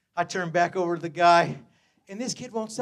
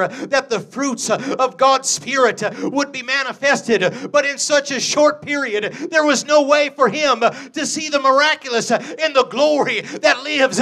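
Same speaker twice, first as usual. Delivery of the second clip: rising louder and higher, preacher's cadence; building sermon delivery; 5 semitones higher, 7 dB louder than usual. Acted. Preacher building to the congregation, swelling sermon voice. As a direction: climb toward the glory.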